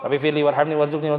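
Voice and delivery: fast, careless rattled-off prayer; mock someone hurrying through it; quick, hurried recitation; rushed prayer. Shown as a demonstration. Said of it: "Kan buru-buru doang".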